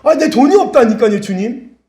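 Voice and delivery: high, loud, whiny insistence; mock exasperated protest. Debtor answers the Lord back, high mock exasperation. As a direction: protest back, imitating someone desperate.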